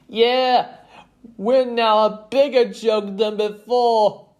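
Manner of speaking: high, very loud complaint; jeering. A narrator's reading. Player joining the mockery, high loud jeer. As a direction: jeer along loudly, high-pitched.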